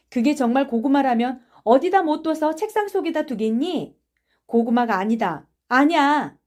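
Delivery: lively schoolyard dispute; arguing. Acted. Children argue about a suspicion.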